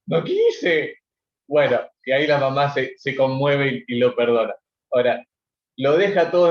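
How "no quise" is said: a lightly voiced whimpering little boy, rising high at the end; crying child. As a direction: play a small muddy child who comes home crying and pleads. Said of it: "viene llorando a la casa".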